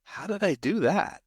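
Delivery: high-pitched baffled question; retold bafflement. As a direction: act out being mystified by it. Retelling own bafflement at wife's reaction.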